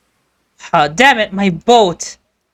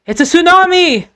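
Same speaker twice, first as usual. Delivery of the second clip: high panicked warning; 7 semitones higher than usual. Acted. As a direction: scream the warning.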